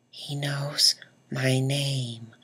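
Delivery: breathless whisper, slow; awed whisper. Retold or imitated. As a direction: whisper it in disbelief. Awestruck kid after meeting star.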